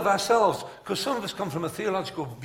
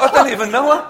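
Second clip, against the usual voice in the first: high, loud comic indignation; mock protest; 11 semitones higher, 15 dB louder than usual. Preacher reacting to the maiden's plea, loudly mock-indignant. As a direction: protest loudly over the laughter.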